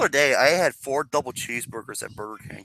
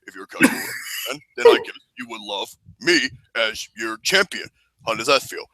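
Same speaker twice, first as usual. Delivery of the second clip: lowered wrestler voice; John Cena impression; 2 semitones lower than usual. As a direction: pose the question as a big wrestler. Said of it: "impression of John Cena meeting Mister Casual".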